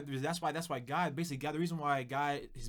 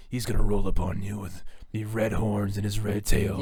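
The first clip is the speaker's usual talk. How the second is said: mock cartoon devil; 5 semitones lower, 10 dB louder than usual. Mocking cartoon devil image.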